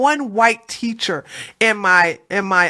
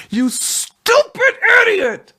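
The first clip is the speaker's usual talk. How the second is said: furious shout; 11 semitones higher, slower than usual. Real, not acted. Host shrieking at a debate guest, furious. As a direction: scream it at him, slow and stretched.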